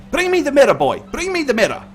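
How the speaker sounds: high, loud, insistent command; urgent order. Acted. Priest ordering the boy to fetch a mirror, urgent. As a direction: demand it at once from an assistant.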